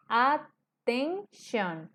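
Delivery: "Attention" muy lento, at... ten... shon; separado en sílabas. Shown as a demonstration. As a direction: tres golpes separados en "Attention". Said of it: "tres sílabas". ("Attention" sílaba por sílaba, con pausas)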